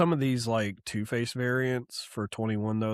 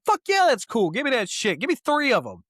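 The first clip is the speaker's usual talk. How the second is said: excited; 11 semitones higher, 4 dB louder than usual. Retold or imitated. His own eager retold reaction.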